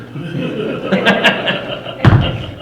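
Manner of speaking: long, high, airy cackle; hearty laugh. Real, not acted. Storyteller laughing at his joke about eating the horse, long cackle.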